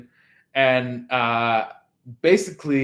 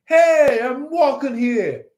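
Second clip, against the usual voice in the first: put-on higher, louder "Hey, I'm walking here" in a New York accent; New York cabbie impression; 8 semitones higher, 5 dB louder, slower than usual. You snapping at the third party, mock New York yell. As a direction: bark it like an offended New Yorker crossing the street. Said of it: "you see them and you're like".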